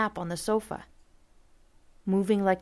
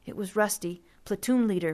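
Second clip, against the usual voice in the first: usual speaking voice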